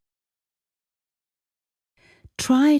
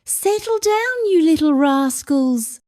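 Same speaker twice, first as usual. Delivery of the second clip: calling out; 11 semitones higher than usual. Acted. Mum calling to bouncing kids, high playful shout. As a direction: call the bouncing kids to settle. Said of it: "Priya might call out".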